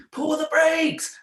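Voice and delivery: high, slow urgent yell; retold shout at little brother; frantic shout. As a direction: yell after the runaway trike.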